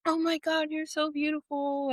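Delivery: put-on high, softened baby-adoring coo; mock cooing. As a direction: imitate someone fawning over a newborn. Imitated cooing over the baby.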